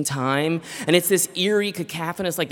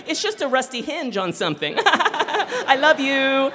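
laughing denial; 9 semitones higher than usual. Retold or imitated. Couple ignoring a screaming rat at dinner, laughing. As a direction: laugh it off nervously.

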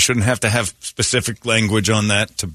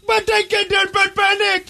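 put-on much higher, squeaky Indian accent for the trapped man; mock Indian accent. Trapped passenger to crew, much higher mock Indian accent. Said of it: "in that voice".

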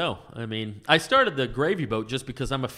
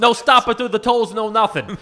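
loud, higher tough-guy prank voice; brash job seeker; 8 semitones higher, 7 dB louder than usual. Retold or imitated. Prank caller answering a want ad, brash. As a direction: belt it out as a pushy Jersey caller. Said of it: "he was calling to get a job".

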